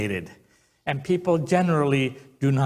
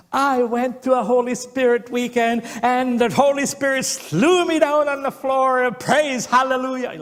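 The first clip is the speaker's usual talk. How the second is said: imitating a loud yell; 10 semitones higher, 4 dB louder than usual. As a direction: belt it out like a zealous nurse at night. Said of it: "And she yells".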